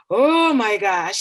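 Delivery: put-on a kid overwhelmed by the text; mock dismayed student. Teacher imitating students seeing hard text.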